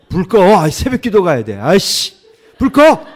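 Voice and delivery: loud, high barking at the wife to kill the light; grumpy husband yelling turn off the light; angry shout. Retold or imitated. Husband snapping at wife at night.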